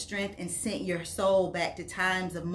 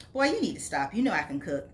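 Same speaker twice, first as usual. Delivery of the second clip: plain reading voice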